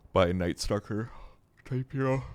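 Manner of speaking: words stretched by a yawn. Real, not acted. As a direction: talk through a yawn. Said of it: "Sorry about the yawn".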